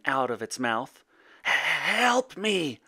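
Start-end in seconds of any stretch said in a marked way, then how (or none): 1.4-2.8 high, drawn-out breathy plea; pleading dragon. A narrator's reading. Dragon begging his son for help, pleading. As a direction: plead as a distressed beast. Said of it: "it pleaded".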